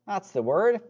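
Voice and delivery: normal talking voice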